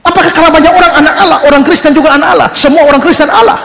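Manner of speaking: fast, high mock challenge; mocking objector. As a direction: rattle off the objection with a sneer. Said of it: "lalu dia bilang".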